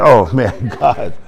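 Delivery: ordinary talk, nothing marked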